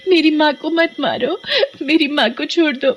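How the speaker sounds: put-on child pleading. Child begs witch to spare mother.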